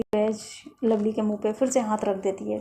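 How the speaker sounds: ordinary delivery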